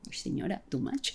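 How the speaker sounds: whispered too-much aside to the officer; unimpressed aside. Retold or imitated. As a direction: whisper it like an eye-roll. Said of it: "la miraba como con cara de".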